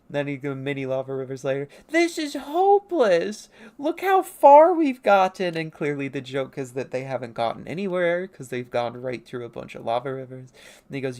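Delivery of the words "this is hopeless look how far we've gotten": very high, slow, loud lament; loud exasperated wail. Acted. Traveler despairing at their progress, loud exasperated wail. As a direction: wail it with every word stretched. Acted complaint in comic.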